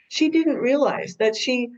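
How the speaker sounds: normal talking voice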